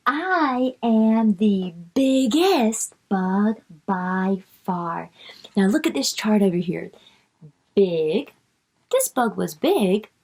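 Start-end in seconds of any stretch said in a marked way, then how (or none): 0.1-5.1 drawn out sounded-out words; slow reading for kids. Shown as a demonstration. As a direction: read each word slowly. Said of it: "bug reading line".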